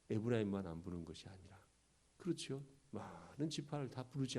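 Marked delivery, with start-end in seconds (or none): none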